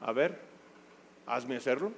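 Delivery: quiet sulky challenge; teen daring his mom under his breath; defiant teen backtalk. Retold or imitated. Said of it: "Rezongó".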